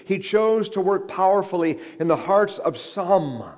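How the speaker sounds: loud, high-pitched sermon delivery; impassioned preaching. Real, not acted. Preacher proclaims to congregation that God chose to intervene, impassioned preaching. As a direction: preach it forcefully, driving each phrase home.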